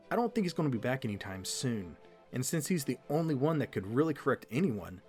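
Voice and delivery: ordinary talk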